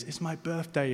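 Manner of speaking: high, soft echo of a call; hushed, high-pitched mountain echo; returning echo. Acted. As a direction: echo it back softly from far away. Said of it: "the voice comes back round through the mountains".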